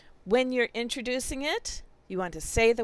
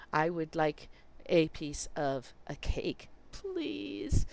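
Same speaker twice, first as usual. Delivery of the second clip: slow phrase; 5 semitones lower, 4 dB quieter, slower than usual. Shown as a demonstration. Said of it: "You have to have it in a phrase".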